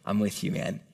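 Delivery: low, soft and comforting; tender reassurance. Acted. Jesus to Paul's lonely heart, tender and hushed. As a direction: quietly reassure a lonely friend. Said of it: "to his lonely heart, Jesus is saying".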